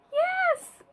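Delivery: squealed high yes; excited yes. Pretend. Teacher cheering the right colour for the kids, very high. Confirming green.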